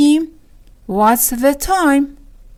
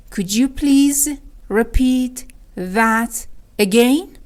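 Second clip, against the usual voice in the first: slow repeat with long gaps; word by word; much slower than usual. Shown as a demonstration. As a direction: piece out "repeat ... that ... again" for learners. (شمرده، کلمه به کلمه)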